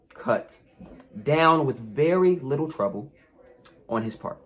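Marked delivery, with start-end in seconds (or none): none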